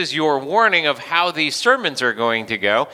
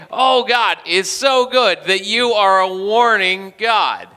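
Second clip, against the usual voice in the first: high, loud, slow hammed-up praise; mock prayer; 5 semitones higher, 4 dB louder than usual. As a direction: gush like an over-earnest prayer. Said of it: "which is kind of a weird thing to call God".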